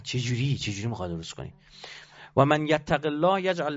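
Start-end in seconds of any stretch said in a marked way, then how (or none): 0.6-1.5 lower, softer doubting question; skeptical doubter. Retold or imitated. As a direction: mutter the doubt under your breath. (پرسش بدبینانه و آهسته)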